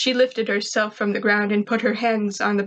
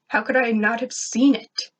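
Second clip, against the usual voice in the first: plain reading voice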